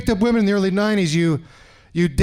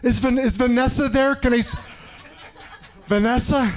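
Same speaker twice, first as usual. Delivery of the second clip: higher, halting hopeful question; acted desperate; 4 semitones higher than usual. Caller asks auto shop for his date, mock desperate. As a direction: play a desperate caller stumbling over the name. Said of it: "you're still desperate".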